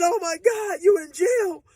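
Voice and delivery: very high, loud tearful wail; crying shout. Retold or imitated. Girlfriend crying to her dazed boyfriend, tearful shout. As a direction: wail it through tears.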